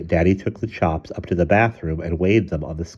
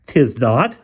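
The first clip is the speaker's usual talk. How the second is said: indignant denial; 6 semitones higher, 6 dB louder than usual. A narrator's reading. Husband contradicts wife about car.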